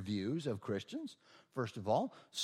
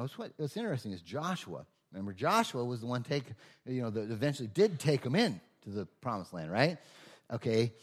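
ordinary delivery

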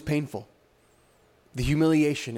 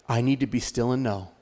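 much lower-pitched, reflective voice; subdued inner thought; 7 semitones lower than usual. Acted. Preacher imagines Jesus' thought while suffering, low and subdued. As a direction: drop low, as if thinking to oneself.

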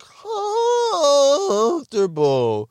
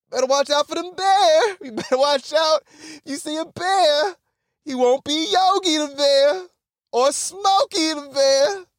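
high, slow, singsong bear warning; hammed-up ranger warning about bears; mock ranger warning; 11 semitones higher than usual. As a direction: warn the tourists like a camp ranger.

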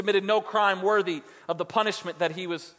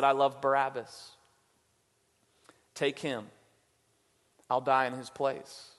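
tender Jesus; 5 semitones lower, 5 dB quieter than usual. Retold or imitated. Jesus voiced, giving his life.

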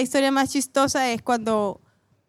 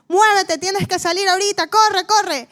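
much higher and louder, urgent mock panic; panicked mother; 9 semitones higher, 5 dB louder than usual. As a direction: hurry the patient out, imitating mom's alarm. Mamá grita que hay temblor. (mamá asustada imitada, mucho más aguda y fuerte)

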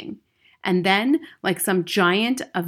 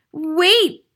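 high, loud panicked plea; flustered schoolboy cry; 11 semitones higher, 8 dB louder than usual. A narrator's reading. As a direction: beg for time. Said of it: "he cried".

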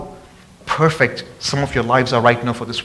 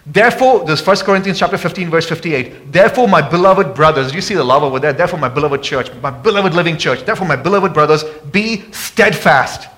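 raised, ringing sermon voice; fervent scripture preaching; 4 semitones higher than usual. Acted. Preacher urging the church to be steadfast, ringing. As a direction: proclaim the verse to the congregation.

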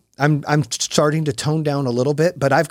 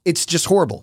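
ordinary delivery

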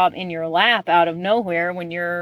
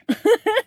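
short laugh; 13 semitones higher than usual. Real, not acted. Laughs at the grumpy quote.

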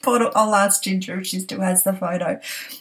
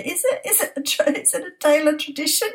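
high, mock-incredulous question; a touch of teasing surprise; 8 semitones higher than usual. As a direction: feign disbelief at her daughter's claim. Mocking daughter's claim of tradition.

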